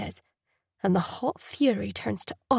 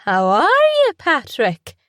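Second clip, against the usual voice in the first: much higher, louder mimicry of his brother; mocking high voice; 7 semitones higher, 8 dB louder, slower than usual. Brother on the phone mocking Patrick's voice, high and sneering. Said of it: "he says in a high voice".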